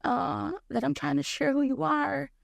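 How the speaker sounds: high pleading voice, lightly done; embarrassed prayer. Retold or imitated. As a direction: plead to God, ashamed. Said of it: "And you said".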